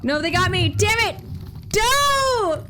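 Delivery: loud cry of dismay when caught; frustrated shout. Real, not acted. Gamer yelling when spotted by guards. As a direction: yell it out, caught again.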